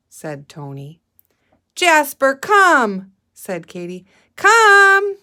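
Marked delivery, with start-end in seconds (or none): 1.8-3.1 higher, louder call; calling the dog. A narrator's reading. Katie calling her dog. 4.4-5.2 much higher, louder command; insistent call. A narrator's reading. Katie calling her dog.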